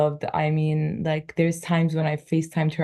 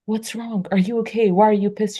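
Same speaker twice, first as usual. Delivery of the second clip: raised-pitch questioning; imitated concern; 5 semitones higher, 4 dB louder, faster than usual. Everyone asking the friend whose smile dropped, mock concern. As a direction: mimic anxious prying questions, voice lifted.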